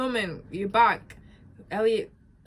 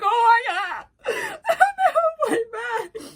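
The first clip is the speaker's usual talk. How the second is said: high, loud mock wail; re-enacted tearful shock; tearing up; 9 dB louder than usual. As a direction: burst into hammed-up crying. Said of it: "I was, like, tearing up".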